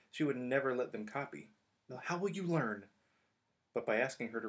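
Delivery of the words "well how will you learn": higher, prim scolding; a little lecture from the know-it-all; prim Hermione. Acted. Hermione refusing to let them copy, prim.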